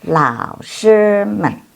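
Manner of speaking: drawn-out, carefully spaced Chinese; slow classroom demonstration of "Lao shi men"; slow model reading. Shown as a demonstration. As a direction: spell out each syllable for learners. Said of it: "teacher models Chinese".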